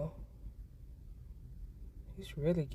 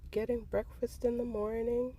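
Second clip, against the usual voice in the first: tender; 8 semitones higher than usual. Real, not acted. Butterfly feeding on flowers.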